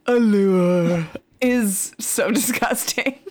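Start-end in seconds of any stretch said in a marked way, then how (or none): 0.1-1.1 a hint of the grandfather's drawn-out allure; lightly mimicking the grandpa's "allure"; imitating Grandpa. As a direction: mimic Grandpa saying "allure", stretched and a bit louder. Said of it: "The way Grandpa says".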